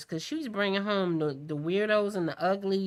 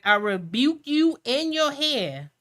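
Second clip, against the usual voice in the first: louder, slow, higher preacher's rebuke; mock preacher rebuke; 4 semitones higher, 5 dB louder than usual. Mother rebuking her son's girlfriend like a preacher. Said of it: "I be like".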